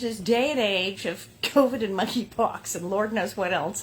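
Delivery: ordinary delivery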